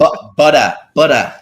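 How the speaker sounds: British accent, butter with a dropped t, butter loud. Shown as a demonstration. To co-host, repeats butter in a loud British accent. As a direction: show off the Birmingham butter. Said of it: "classic words people get me to say in my British accent".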